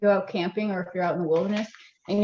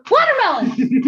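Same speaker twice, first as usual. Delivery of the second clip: high yelped hiccup word; fake hiccup; 12 semitones higher, 6 dB louder than usual. Shown as a demonstration. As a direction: squeak it out like a hiccup. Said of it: "do hiccup".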